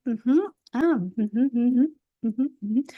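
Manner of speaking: quick, lively ujú, ujú murmurs; attentive ujú sounds. Shown as a demonstration. Coach showing active listening with lively ujú. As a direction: nod along aloud with eager ujú. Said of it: "voy a estar".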